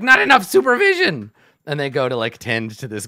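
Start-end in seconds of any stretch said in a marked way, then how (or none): 0.0-1.3 high, loud goblin shout; panicked goblin bosses crying out; alarmed goblins. Retold or imitated. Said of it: "they're like".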